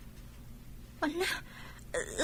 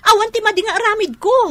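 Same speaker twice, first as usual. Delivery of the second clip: louder, higher protest of innocence; angry denial; 5 semitones higher, 6 dB louder than usual. Acted. Accused woman shouting back at the wife, angry denial. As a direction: push back hard, she is accused.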